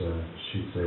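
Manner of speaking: ordinary talk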